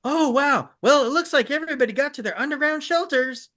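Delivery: high, fast, chirpy voiceover glossing over the disaster; mocking the cartoon's voiceover, upbeat and high; cheesy cartoon narrator. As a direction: sell the cover-up like a bright kids' announcer. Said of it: "the voiceover would go".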